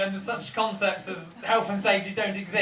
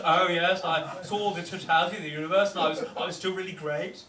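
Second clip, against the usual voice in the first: ordinary delivery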